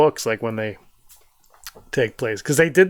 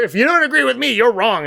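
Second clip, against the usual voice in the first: higher-pitched mock know-it-all; self-righteous insistence; 8 semitones higher than usual. Mimicking online arguers who think only their opinion is right, self-righteous.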